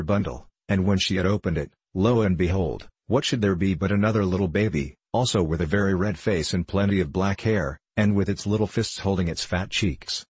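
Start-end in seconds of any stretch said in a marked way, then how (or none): none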